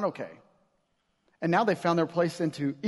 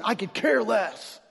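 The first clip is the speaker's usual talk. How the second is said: drawn-out, higher exclamation; emphatic couldn't-care-less; 4 semitones higher, slower than usual. Acted. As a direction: stretch it out as a big, overdone dismissal. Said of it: "It's not saying".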